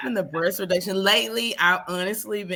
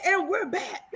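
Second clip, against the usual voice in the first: show host announcement; 11 semitones higher than usual. Retold or imitated. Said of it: "I said".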